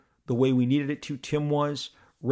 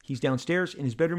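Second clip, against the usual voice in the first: usual speaking voice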